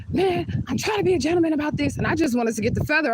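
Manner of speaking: high, loud mock yell of an upset man; imitated yelling.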